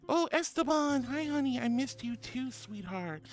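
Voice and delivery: high, sweet pet greeting; cooing at the pet; affectionate. Real, not acted. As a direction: coo at the pet lovingly. Greeting pet Esteban lovingly.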